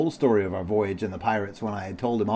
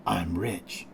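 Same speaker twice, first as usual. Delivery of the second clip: hushed, softer secret; confiding whisper; 3 semitones lower, 8 dB quieter than usual. A narrator's reading. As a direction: drop the voice as if sharing a secret. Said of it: "he whispered".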